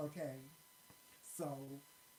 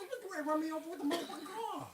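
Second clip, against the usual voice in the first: shrieking retell; 12 semitones higher, 6 dB louder, much faster than usual. Retold or imitated. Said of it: "I was like".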